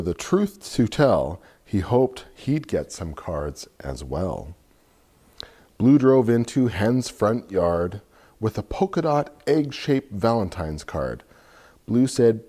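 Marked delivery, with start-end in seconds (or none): none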